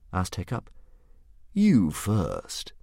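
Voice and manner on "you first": usual speaking voice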